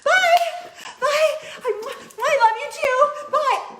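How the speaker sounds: very high, loud, overexcited farewells; hammed-up emotional mom squeal; gushing goodbye. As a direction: call out adoring goodbyes at full gush. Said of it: "and this is me".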